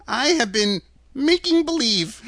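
put-on high, tittering old-man confession; timid, giggly little fellow; sheepish little man. A narrator's reading. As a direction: own up meekly in a high voice.

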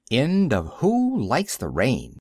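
ordinary delivery, nothing marked

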